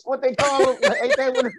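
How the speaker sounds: laughing through the words. Real, not acted. Laughing at mixing up cicadas, giggling. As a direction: crack up mid-question.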